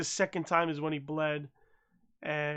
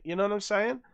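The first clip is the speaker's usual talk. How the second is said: ordinary delivery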